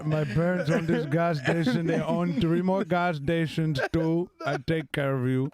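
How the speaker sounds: put-on Indian accent, laughing, pleading; wooing in an Indian accent. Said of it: "Indian gas station wedding proposals".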